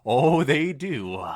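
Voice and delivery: loud, slow nervous assurance; nervous insistence. Acted. Twilight nervously assuring Rainbow, loud and slow. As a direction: insist too hard, sweating. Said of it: "about to start sweating".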